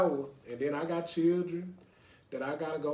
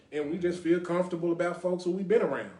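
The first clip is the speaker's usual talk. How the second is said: ordinary talk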